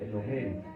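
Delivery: Eloheinu with a soft breathy G-like H sound. Shown as a demonstration. Pastor showing the class the soft sound in Eloheinu. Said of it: "pero es una G suave".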